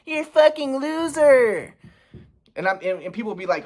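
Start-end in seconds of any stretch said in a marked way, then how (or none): 0.0-1.8 much higher, slow mock of mom scolding. Son imitates mom scolding him, high mocking voice. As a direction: sneer it as his mom, pitched way up. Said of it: "my mom's like".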